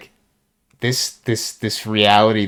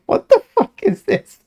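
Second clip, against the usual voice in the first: squeaky disbelief. Retold or imitated. Retold disbelief at furry site.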